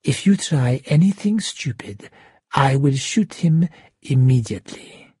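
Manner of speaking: restrained, menacing villain voice. A narrator's reading. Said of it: "quieter voice".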